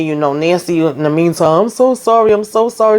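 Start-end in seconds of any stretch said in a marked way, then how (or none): 1.6-3.0 higher-pitched, lightly acted apology; imitated apologetic wife. Nancy apologising to her guests, imitated high apologetic voice. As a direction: imitate a flustered hostess, pitched up.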